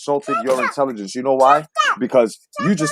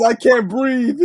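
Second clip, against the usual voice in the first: mock breathless; 8 semitones higher, slower than usual. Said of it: "Tony says".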